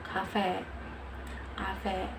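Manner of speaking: cafe said "ka-fe" in a Thai accent. Shown as a demonstration. Learner compares one word in three accents, Thai accent. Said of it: "in Thailand accent".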